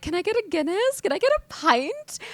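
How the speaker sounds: very high-pitched, eager tourist questions; annoying American girl. Acted. American girl ordering in a London pub, annoyingly eager. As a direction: play up an overeager American abroad. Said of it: "I wanna be that annoying American girl".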